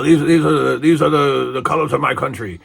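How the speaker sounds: loud, high mock declaration; Nikolai. Wrestler declaring his country's colors, imitated loud protest. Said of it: "I can't do the Russian voice, but".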